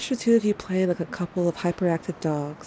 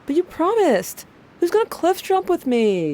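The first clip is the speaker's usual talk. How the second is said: much higher, louder, whiny protest, slowed; pouting; 12 semitones higher, 5 dB louder than usual. Acted. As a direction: sulk like a kid denied a promise. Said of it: "You pouted".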